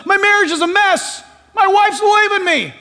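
高声惊慌地喊出，重现那位绝望的丈夫; 喊着说出婚姻破裂的绝望; distraught outcry. Retold or imitated. Distraught man quoted.

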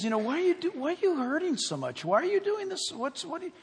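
high, pleading concern; God's loving appeal. Acted. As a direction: plead tenderly with someone who is hurting. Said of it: "and he, and he says".

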